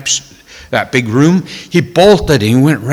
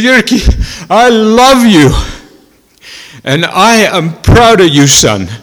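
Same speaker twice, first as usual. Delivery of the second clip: high, loud, slow emotional outpouring; a crying father pouring out his love; weeping declaration; 7 semitones higher, 5 dB louder, slower than usual. Retold or imitated. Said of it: "just crying hysterically".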